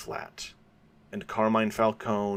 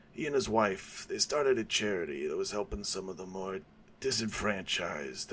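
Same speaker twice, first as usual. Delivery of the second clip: put-on old mob boss; 6 dB quieter than usual. Old gangster boasting to Batman, lightly voiced. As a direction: ease into an old mobster's confession.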